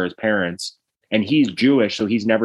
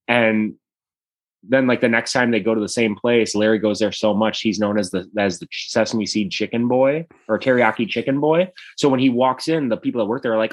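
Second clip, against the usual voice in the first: usual speaking voice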